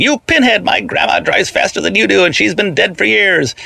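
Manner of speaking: high, mock shout of an insult; shouting from the truck. A narrator's reading. Rehnquist hanging off the truck yelling at the driver, high mock shout. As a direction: yell the taunt up high from the back. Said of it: "He kept on shouting".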